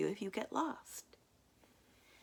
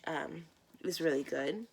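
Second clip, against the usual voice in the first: plain reading voice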